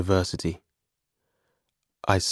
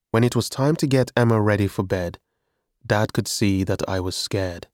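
plain reading voice

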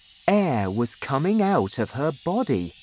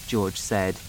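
plain reading voice

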